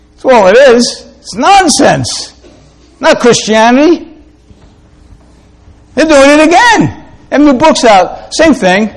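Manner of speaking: high-pitched, emphatic dismissal; emphatic preaching. Real, not acted. Preacher warning his people about bad theology, emphatic.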